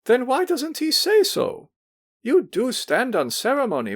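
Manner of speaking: sing-song drawl. A narrator's reading. Hostess chiding Pavel about his cold, sing-song drawl. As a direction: drawl it like a fussy matron. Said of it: "drawled in a sing-song voice".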